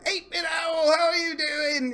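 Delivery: big high-pitched shouted greeting; Fraz impression. Fraz greeting a newcomer in chat, high hearty impression. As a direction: call it out high, hailing a newcomer. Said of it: "Fraz said, ... that's not how you sound at all".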